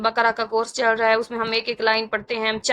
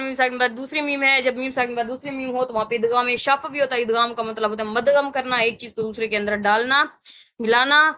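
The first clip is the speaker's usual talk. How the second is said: usual speaking voice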